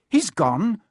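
dismayed disbelief; frantic. A narrator's reading. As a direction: blurt it in rising panic. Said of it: "said Harry frantically".

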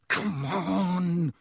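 drawn-out strained plea; muttered. A narrator's reading. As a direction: urge it on under strain. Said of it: "Ron muttered".

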